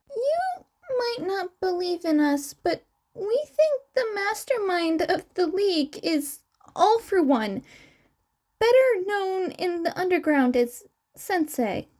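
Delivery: high twin voice. Acted. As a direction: chirp the lines up high like the young twin.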